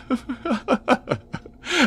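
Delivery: high manic cackle of a madman; insane laughter. Acted. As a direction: burst into a mad high cackle. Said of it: "the sound of his insane glee".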